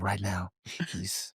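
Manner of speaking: low, hushed host voice, lightly done; a hint of the Cheaters host leading them in; Joey Greco voice. Retelling a Cheaters episode, mimics the host low and hushed. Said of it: "That is exactly the voice as well".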